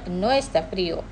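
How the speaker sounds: usual speaking voice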